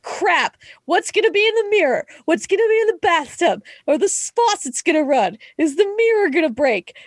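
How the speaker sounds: high, quick worried rambling; imagine the horror in the bathroom; mock dread. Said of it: "And I'm like".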